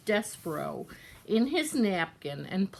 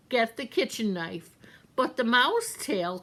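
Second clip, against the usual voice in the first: ordinary delivery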